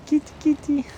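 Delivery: very high sing-song kitty call; cat-calling voice. Real, not acted. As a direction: coax a cat out in a tiny high voice. Coaxing a stray cat.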